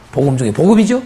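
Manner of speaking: normal talking voice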